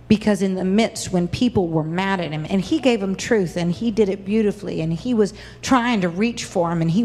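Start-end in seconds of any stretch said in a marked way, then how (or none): none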